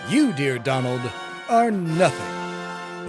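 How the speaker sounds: normal talking voice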